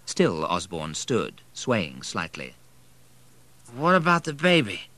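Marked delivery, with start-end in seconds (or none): 3.7-4.9 put-on high, slurred drunk asking dazedly; drunken question. A narrator's reading. Drunk husband asking about the baby, high and slurred.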